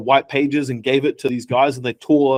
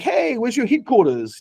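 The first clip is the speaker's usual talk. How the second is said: high-pitched curious caller; chirpy imitation of a caller's question; customer asking; 8 semitones higher than usual. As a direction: put on a high, inquisitive customer voice. Acting out American callers on sales calls.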